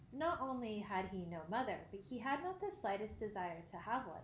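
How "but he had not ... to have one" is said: usual speaking voice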